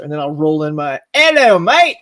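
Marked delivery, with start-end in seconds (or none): 1.1-2.0 loud high British "Hello, mate."; cheery mock Aussie-British shop greeting; hammed-up British accent, louder and higher. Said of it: "why I choose to say it like that".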